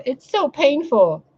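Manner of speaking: high, slow mocking whine; whiny mockery. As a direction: mimic a self-pitying victim, drawn out. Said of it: "and we go".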